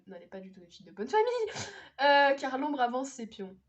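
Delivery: carried away, bursting out loud; worked up. Real, not acted. Vlogger objecting to a character's description, worked-up outburst. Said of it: "Pardon, je me, je me calme".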